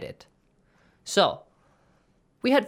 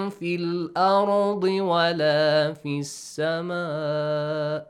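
Quran recitation; 2 semitones higher, 4 dB louder, faster than usual. Shown as a demonstration. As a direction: recite it with tajweed. Teacher recites Quran verse.

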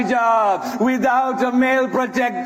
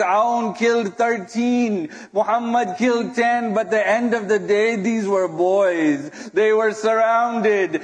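ordinary talk

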